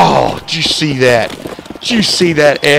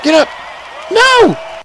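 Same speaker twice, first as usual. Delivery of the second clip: loud, high-pitched cry of dismay; desperate shout; 3 dB louder, slower than usual. Real, not acted. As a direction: scream no as the jump fails. Player fails jump in game.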